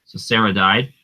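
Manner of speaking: normal talking voice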